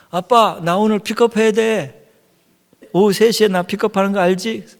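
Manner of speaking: put-on high, insistent kid on the phone; imitating a child demanding a ride; child calling dad. Acts out child phoning dad.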